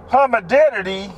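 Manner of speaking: put-on Humidity said as hummy-deh-ditty like a child; kid mispronunciation. Shown as a demonstration. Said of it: "That's how kids say it".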